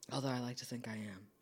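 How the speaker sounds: whispered aside, half joking; whispering. Real, not acted. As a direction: drop to a whisper for the joke. Cheeky aside to listeners.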